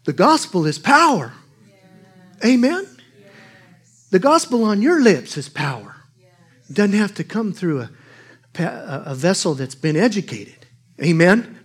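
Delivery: loud, high preaching build. Acted. Preacher urging the congregation, loud and rising. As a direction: lift the church with it.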